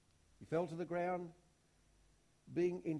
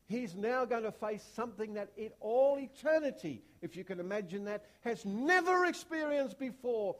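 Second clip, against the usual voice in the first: preaching at full force; 6 semitones higher, 10 dB louder than usual. Real, not acted. Preacher climbing to the sermon's peak, loud and high. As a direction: build to a thundering climax.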